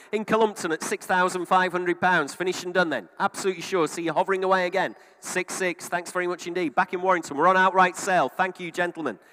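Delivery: loud auctioneer calling bids; auction bid spotter. Real, not acted.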